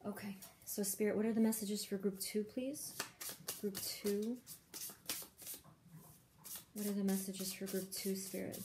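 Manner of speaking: soft, murmured request to Spirit; quietly calling on spirit guides over the cards; hushed asking. Real, not acted. Murmured questions to Spirit while shuffling.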